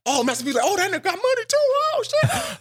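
high, excited crowd reaction; imitating amazed onlookers.

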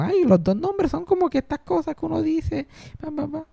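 put-on high-pitched child doing a bit; little kid. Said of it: "cuando yo era chiquito yo le decía eso". (voz aguda de niño chiquito)

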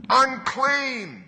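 high, loud leper's call; shouted warning cry. Acted. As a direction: cry it out to people at a distance. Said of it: "you had to shout out".

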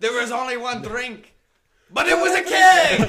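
rowdy Irish pub chant shout; Irish cheer. Retold or imitated. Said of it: "that was like".

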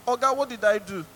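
acted indignation building in volume; indignant protest. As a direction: plead your innocence, then protest loudly. Acts citizen protesting to the policeman.

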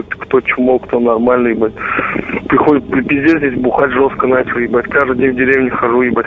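ordinary delivery

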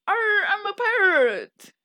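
high, loud, drawn-out pirate parody; over-the-top pirate. Retold or imitated. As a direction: ham up a stock pirate, slowly. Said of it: "way too much pirate".

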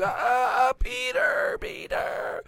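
high-pitched swooning fan cries; imitated shrieking fans, very high; mock fan screaming. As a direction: squeal his name like an adoring crowd, hammed-up. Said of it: "and now everybody's".

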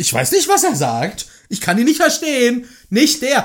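high, loud acted yelling; hammed-up cry of refusal to understand; mock shouting. Acted shouting over the other person.

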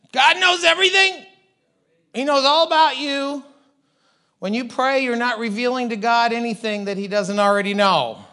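high, loud fervent preaching; preaching at full force. Real, not acted. Preaching climax.

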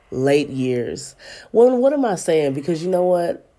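ordinary talk, nothing marked

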